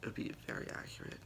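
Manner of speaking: quick voiceless whisper aside; fast conspiratorial whisper. Real, not acted. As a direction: whisper it as a secret joke. Aside about feeling a hot mess.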